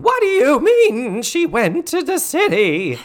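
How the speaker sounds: hammed-up high, drawn-out screech; mock outraged yell. Recapper playing Missus Garrett yelling at Miko, mock outraged yell. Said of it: "she is yelling at Miko".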